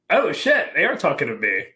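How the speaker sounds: high-pitched retold jolt of alarm; startled realization. Soldier realizing he is being hailed, startled realization. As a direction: act out suddenly catching on, pitch leaping.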